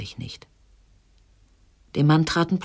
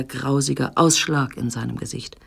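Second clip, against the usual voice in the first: usual speaking voice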